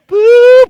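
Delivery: high, loud spooky boo; high loud ghostly boo. Acted. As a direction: shout it to scare the bear. Ghost scaring.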